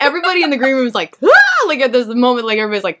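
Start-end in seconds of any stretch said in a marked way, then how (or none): 1.2-1.7 very high, loud shocked "ah"; retold collective shriek; panicked gasp. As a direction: imitate a whole room gasping in alarm. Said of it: "tense, like, they panic".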